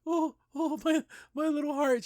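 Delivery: high, squeaky imitation of a sob-choked girl; mock crying. Recapping Anne's tearful moment, in a mock crying voice. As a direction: whimper it like a kid about to cry, hammed-up. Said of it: "She starts to cry".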